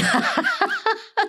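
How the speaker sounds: high delighted laugh; real laugh at her own story; burst of laughter. Real, not acted. Mother on the podcast cracking up at her son's reply.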